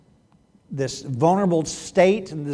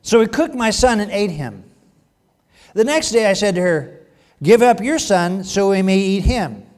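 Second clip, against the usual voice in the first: loud, higher-pitched dramatic Bible reading; forceful, raised reading voice; scripture reading; 3 semitones higher, 6 dB louder than usual. A narrator's reading. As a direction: project the woman's account to the congregation.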